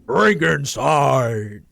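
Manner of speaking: slow booming announcer; monster truck ad. Pretend. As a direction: stretch it out loud like an ad. Continues monster truck ad parody.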